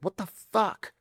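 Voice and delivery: exasperated. Retold or imitated. Exasperated ambassador on the phone.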